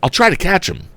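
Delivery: high urgent call. A narrator's reading. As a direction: call it out while riding. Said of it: "yelled the young inventor".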